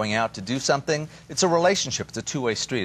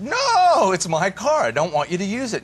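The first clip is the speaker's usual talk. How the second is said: higher, louder mock-indignant no; possessive refusal; 8 semitones higher, 5 dB louder than usual. Husband refusing to lend his new Lexus, mock indignant and higher. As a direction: bark a high, outraged refusal over the new car. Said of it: "He said".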